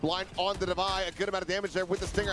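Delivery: rising play-by-play call; esports caster. Real, not acted. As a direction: call the action as it builds.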